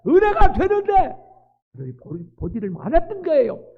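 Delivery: high, loud preacher's cry; fervent preaching. Acted. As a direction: preach it out with full force. Preaching at full force.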